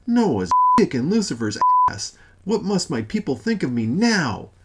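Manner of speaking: loud high frantic cursing; raging shout. Acted. God running in circles, frantic raging shout. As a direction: spit the curse out in panic. Father raging, tearing hair.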